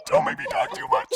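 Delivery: high, fast inhaled voice; talking while inhaling. Acted. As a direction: squeeze words out while breathing in. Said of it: "I'm speaking while inhaling".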